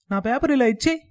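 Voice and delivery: put-on a boy's high, bossy demand. A narrator's reading. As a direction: order him to hand it over. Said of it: "రుబాబుగా అడిగాడు". (పిల్లాడు పెత్తనంగా అడగడం)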